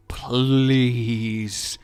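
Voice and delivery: very slow, loud drawn-out moan; whiny sleepy plea.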